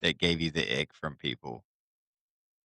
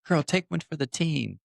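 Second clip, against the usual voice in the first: put-on girlfriend voice; 8 semitones higher than usual. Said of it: "the girlfriend saying".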